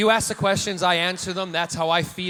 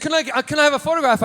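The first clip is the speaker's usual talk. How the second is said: eager fan; 10 semitones higher, 5 dB louder, much faster than usual. Retold or imitated. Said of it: "he said".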